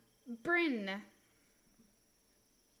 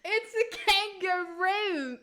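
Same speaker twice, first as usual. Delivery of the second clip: put-on loud, high, slow Aussie accent; exaggerated Australian accent; 6 semitones higher, 7 dB louder, slower than usual. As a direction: stretch it out like a hammed-up Australian. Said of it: "See, I know Australian".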